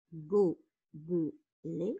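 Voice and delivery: "Gobelet" slowly in three beats, go-be-let; split into syllables. Shown as a demonstration. Teacher counting syllables for pupils, "Gobelet" split as go-be-let. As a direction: sound out "Gobelet" with a pause after each syllable. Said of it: "trois syllabes".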